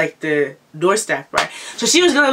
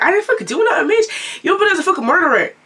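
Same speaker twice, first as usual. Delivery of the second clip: high-pitched, mock-tough retort; bad bitch defiance; 13 semitones higher, 3 dB louder than usual. Teller standing up to the attacker, high defiant comeback. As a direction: act tough, voice raised high. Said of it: "stand like a bad bitch".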